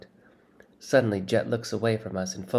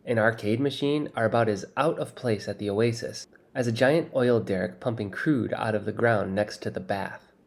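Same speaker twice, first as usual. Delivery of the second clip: ordinary delivery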